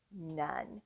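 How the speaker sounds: plain reading voice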